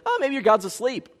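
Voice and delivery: teasing. Acted. Elijah making fun of the pagans, teasing. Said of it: "He starts teasing them".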